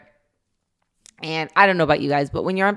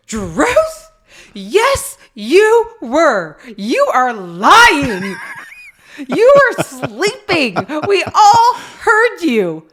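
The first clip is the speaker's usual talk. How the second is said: high, loud, slow laughing scold; loud stern dad, laughing through it; raised voice; 10 semitones higher, 7 dB louder, much slower than usual. Retold or imitated. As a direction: scold him while cracking up. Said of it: "Greg's raises his voice".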